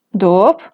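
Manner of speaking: doop said with a rising pitch. Shown as a demonstration. Teacher recalling a known rising-tone word, rising. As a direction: glide doop upward. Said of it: "tiene tono ascendente".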